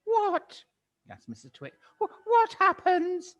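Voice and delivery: put-on high, breathless old-woman gasp; frightened gasp. Missus Twit terrified of shrinking, gasping at her husband. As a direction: gasp it out in terror. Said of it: "gasped Missus Twit".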